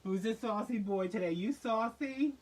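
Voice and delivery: put-on loud, slow gushing baby voice; doting dog talk. She gushes over a snarling pit bull in loud slow baby talk. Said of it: "I'd be like".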